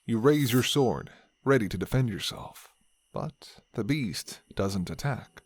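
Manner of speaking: ordinary delivery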